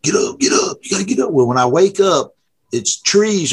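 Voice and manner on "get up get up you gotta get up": mock holler. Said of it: "[name] starts hollering".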